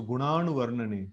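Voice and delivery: "gunanu" said with the tongue curled back on the n; retroflex n in gunanu. Shown as a demonstration. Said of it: "we have to bend the tongue".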